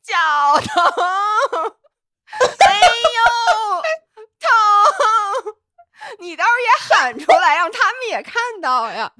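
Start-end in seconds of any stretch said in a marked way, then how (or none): 0.0-5.5 hammed-up whimpering cry of pain, higher and louder; mock pained wailing. Friend suggesting she whine while running, mock crying wail. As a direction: fake a crying runner moaning about a sore foot. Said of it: "你一边跑一边喊".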